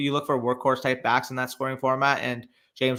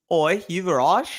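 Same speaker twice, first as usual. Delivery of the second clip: attempted British accent, higher and louder, rising at the end; Australian-sounding British; 6 semitones higher, 5 dB louder, slower than usual. Reading out a name in attempted British, Australian-sounding accent. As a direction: call out in a shaky British accent that slips into Australian. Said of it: "That sounded more Australian than British".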